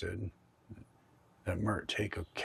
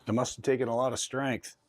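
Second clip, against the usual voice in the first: addressing a spirit; 3 semitones higher, 5 dB louder than usual. Real, not acted. Addressing a ghost named Mert.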